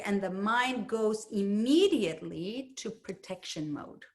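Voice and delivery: usual speaking voice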